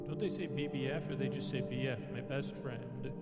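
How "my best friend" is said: usual speaking voice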